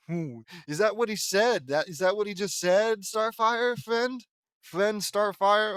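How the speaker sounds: can you believe this; is that really what he said; incredulous. Real, not acted. Incredulous at the character's line.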